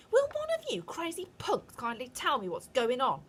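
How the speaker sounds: put-on loud, high indignant boy; shouting boy. A narrator's reading. Bruno shouting at the witches, loud and indignant. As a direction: yell it at the crowd of witches. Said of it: "shouted Bruno".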